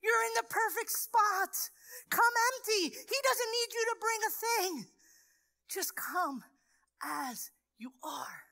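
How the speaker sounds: a pastor's tender urging; tender encouragement. Retold or imitated. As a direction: lift her up gently but firmly. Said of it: "And I'm telling her".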